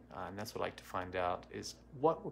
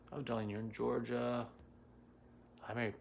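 normal talking voice

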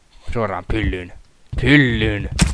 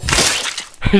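unvoiced burst of laughter; breathless laugh; 5 dB louder than usual. Real, not acted. Gamer laughing at a game mishap, breathless laugh. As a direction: laugh out in disbelief.

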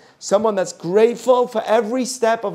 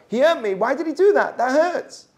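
higher, whiny child complaint, lightly played; a child telling a parent someone hurt him, in mock tearful whine; mock child crying; 8 semitones higher than usual. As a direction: imitate a hurt kid about to cry. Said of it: "The kid will cry straight away".

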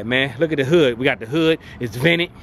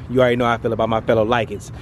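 ordinary talk

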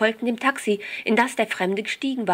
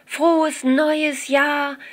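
higher, louder, very slow drawn-out New Year's shout; loud crowd cheer; 7 semitones higher, 4 dB louder, much slower than usual. A narrator's reading. Party guests cheering each other at midnight, loud and drawn out. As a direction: call it out with the whole crowd at midnight. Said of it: "erklang es lautstark von überall her".

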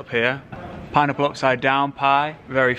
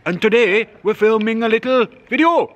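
exaggerated Welsh lilt, pitched well up; Welsh accent impression; 9 semitones higher, 4 dB louder than usual. Co-host mimicking a guest to camera, hammed-up Welsh accent. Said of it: "best Welsh accent I've ever done".

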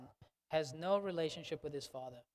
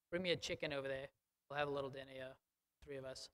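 mock-casual, dropping much softer; a hint of a penny-pinching father, softer; stingy small offer; 4 semitones lower, 6 dB quieter, much faster than usual. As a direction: toss it off quietly like a cheap host. Father's stingy alternative, mock quote.

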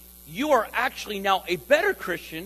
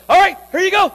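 mock loud bracing; 11 semitones higher, 13 dB louder than usual. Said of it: "Sometimes you go".

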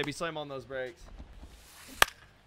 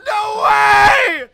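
high, loud yelling in disbelief; disbelieving shout; 13 semitones higher, 12 dB louder, slower than usual. Real, not acted. Gamer reacting to a crushed pitch, shouting. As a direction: scream like you can't believe it.

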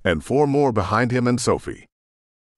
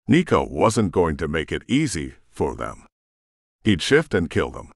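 ordinary delivery

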